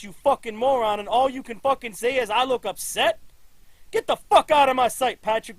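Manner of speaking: loud, high enraged yelling; a grieving father exploding at his officer; furious shout. Acted. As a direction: rage at the officer who failed his daughter. Father learns daughter murdered.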